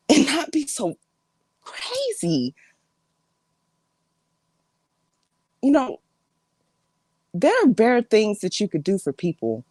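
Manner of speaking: exasperated family confrontation with big swings in pitch; fed-up relative confronting drama-seeker. Acted. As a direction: scold an attention-seeking relative, pausing between jabs.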